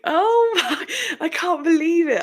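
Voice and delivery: high, laughing mock dismay; laughing disbelief. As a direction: giggle through the disbelief. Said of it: "It's just like".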